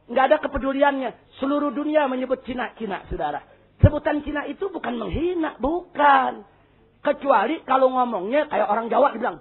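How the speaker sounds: usual speaking voice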